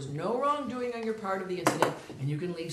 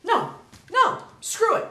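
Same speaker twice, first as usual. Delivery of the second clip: much higher and louder, losing it; furious outburst; 13 semitones higher, 9 dB louder, slower than usual. Acted. Officer explodes at the jumper over her cancelled date, furious. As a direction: snap after trying to calm down.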